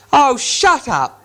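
high, loud, slow drawn-out shut up; exasperated trolls. Acted. Trolls silencing the voice, slow high troll shout. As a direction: stretch it out in disgust. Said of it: "they said".

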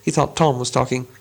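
plain reading voice

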